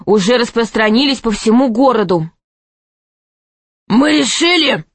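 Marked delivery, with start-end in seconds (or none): 3.9-4.8 higher-pitched royal proclamation; king's announcement. A narrator's reading. King resuming his verdict to the stadium, proclaiming. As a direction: declare it to the crowd. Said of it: "заявил".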